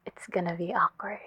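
soft unvoiced whisper. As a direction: breathe it out as an aside. Whispered aside about awkwardness.

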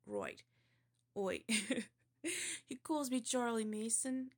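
shaky-attempt West Country accent, laughing early on; hammy amateur West Country burr. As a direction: recite it as a West Country lad. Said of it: "this is a West Country accent, supposedly".

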